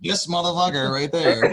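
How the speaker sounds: laughing as he calls him out; playful laughing jab. Real, not acted. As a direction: joke it out mid-laugh. Pointing at the guest, laughing.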